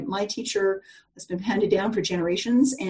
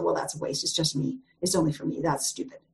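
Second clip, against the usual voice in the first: softer, low, grumbling to herself; muttered complaint; 4 semitones lower, 6 dB quieter than usual. Retold or imitated. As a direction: grumble it like she's fed up with herself. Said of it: "she said".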